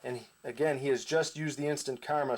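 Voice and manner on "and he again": normal talking voice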